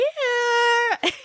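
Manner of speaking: high laugh. Real, not acted. Laughing at weird scene.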